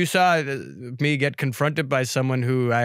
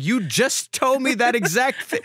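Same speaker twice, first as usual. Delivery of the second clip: incredulous mock voice; 11 semitones higher, slower than usual. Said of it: "I was like".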